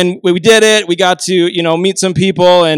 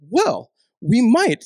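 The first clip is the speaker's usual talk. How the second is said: slow, drawn-out, higher-pitched musing; perky receptionist; 4 semitones higher, slower than usual. Retold or imitated. Receptionist to young job seeker, perky teasing consideration. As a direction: imitate a woman perking up behind a desk.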